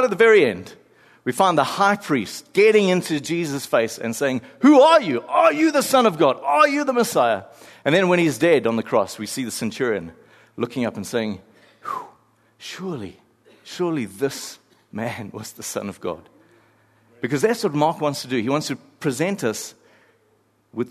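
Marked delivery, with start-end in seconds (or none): 4.6-7.4 much higher, louder accusing demand; high priest confronting. Acted. High priest in Jesus' face, shouting demand. 11.8-16.3 softer, hushed awe; awed centurion. Acted. Centurion at the cross, hushed awe.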